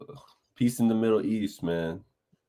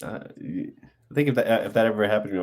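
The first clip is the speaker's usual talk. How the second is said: usual speaking voice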